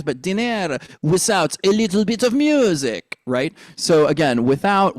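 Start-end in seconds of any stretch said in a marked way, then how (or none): 0.0-3.2 French accent. Shown as a demonstration. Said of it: "It's not dinner". 4.5-5.0 "without" with TH as S, "wisout"; French "without". Shown as a demonstration. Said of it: "we'll drop the T H".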